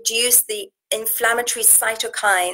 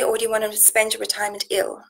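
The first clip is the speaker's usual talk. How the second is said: ordinary delivery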